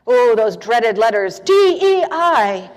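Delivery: drawn-out, emphatic mock alarm; mock dread. Minister teasing congregation about dreaded DEI letters, in mock dread. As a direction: spell out the dreaded letters slowly and loudly.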